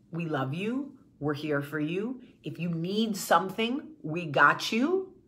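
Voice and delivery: soft, low and tender, like holding someone close; tender reassurance. Pretend. Reassuring a struggling man.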